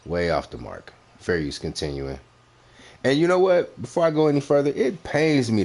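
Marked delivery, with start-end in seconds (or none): 3.0-5.6 high, loud, worked-up outburst; winding up to a real tirade; heated rant. Real, not acted. Ranting at the rival host, high and loud.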